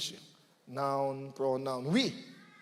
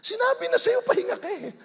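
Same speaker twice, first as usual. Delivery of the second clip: much higher, louder with a laugh; mock scolding; 5 dB louder than usual. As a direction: tease them like a nagging parent. Scolding the one who didn't listen.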